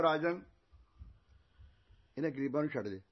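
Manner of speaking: loud appeal to a king; calling out. Acted. Thief calls out to the king. (ਉੱਚੀ ਆਵਾਜ਼ 'ਚ ਪੁਕਾਰ)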